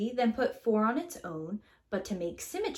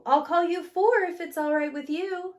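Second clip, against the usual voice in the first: high, bright naming; cheery welcome; 7 semitones higher, 5 dB louder than usual. Acted. Narrator names the new number, higher and brighter. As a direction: warmly welcome the newcomer.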